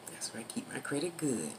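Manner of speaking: half-whispered, confiding remark; soft, low-key aside to the listener; hushed aside. Real, not acted. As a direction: drop right down to a near whisper. Hushed aside after loud nagging.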